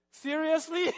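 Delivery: loud, high laughing seriously; mock him through a laugh; laughing disbelief. Wife mocks his anger.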